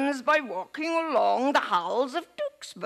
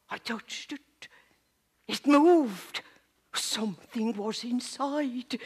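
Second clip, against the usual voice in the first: normal talking voice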